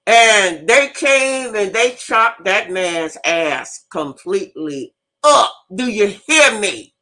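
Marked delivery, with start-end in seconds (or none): none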